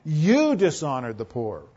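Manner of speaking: slow line with "You" long and punched; stress on YOU. Shown as a demonstration. Preacher voices James rebuking his readers, emphatic "You". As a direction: lean hard on "You" as an accusation. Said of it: "It's emphatic. That's what James does here".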